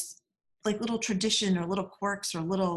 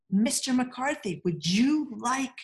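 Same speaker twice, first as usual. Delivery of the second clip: overly slow and careful; 7 semitones higher, slower than usual. Retold or imitated. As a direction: spell it out slowly for the foreigner. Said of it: "I figured he couldn't understand me".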